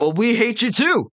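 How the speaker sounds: high sneering hobgoblin retort. Acted. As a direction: snap back like a petty goblin.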